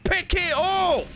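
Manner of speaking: very high, drawn-out shout; exasperated yell. Real, not acted. Player yelling at his game character, exasperated high shout. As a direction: yell it high, stretching the last word.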